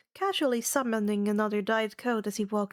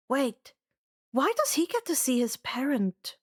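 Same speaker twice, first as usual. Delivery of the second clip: ordinary delivery, nothing marked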